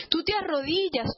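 ordinary delivery

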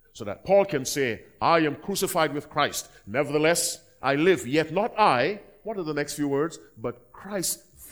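preacher crying out Paul's words; fervent preaching. Acted. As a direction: proclaim the verse. Quoting scripture forcefully.

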